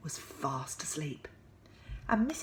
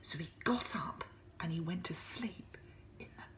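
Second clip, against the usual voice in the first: whisper; 9 dB quieter, faster than usual. A narrator's reading. Whispered narration.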